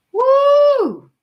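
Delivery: sudden high, loud whoop; whooping shout. Real, not acted. Mom warning anyone near her daughter, loud high whoop. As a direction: let out a fierce protective whoop. Fierce mama bear about daughter.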